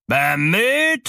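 high, loud outraged question; enraged tyrant's rising shout; furious yell. A narrator's reading. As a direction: yell it back in disbelief, pitch soaring. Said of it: "schrie der Tyrann wütend".

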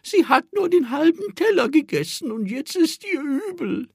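put-on high, thin old lady voice; reedy little old woman. A narrator's reading. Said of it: "mit dünner Stimme".

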